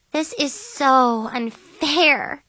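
very slow, high whine; drawn-out teenage grumble; groaning complaint. A narrator's reading. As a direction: groan it out, stretching every word. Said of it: "Chloe groaned".

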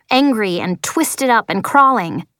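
plain reading voice

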